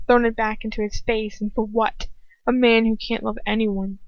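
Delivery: ordinary delivery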